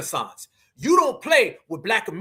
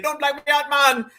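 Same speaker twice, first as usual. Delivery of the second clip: higher-pitched mock Jamaican plea; imitation Jamaican accent, pitched up; 6 semitones higher than usual. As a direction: plead in a put-on Jamaican accent. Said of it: "the Jamaican saying".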